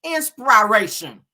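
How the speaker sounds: "Inspiration" drawn out slowly, sounded out; mock thick tongue. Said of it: "wrote the shit down like".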